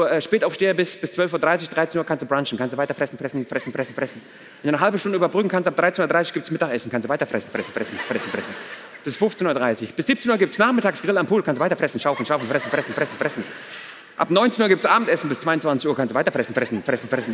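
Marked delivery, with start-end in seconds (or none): 2.6-4.2 low, soft running chant; 単調な反復. Acted. Comic chant of endless gorging. 6.9-8.5 low, soft running chant; 単調な反復. Acted. Comic chant of endless gorging. 11.4-13.4 単調な反復. Acted. Comic chant of endless gorging. 16.0-17.3 単調な反復. Acted. Comic chant of endless gorging.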